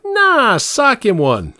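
put-on high, goading child voice; bratty kid. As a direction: egg on dad like a brat. Bratty kid egging on dad.

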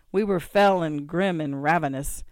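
usual speaking voice

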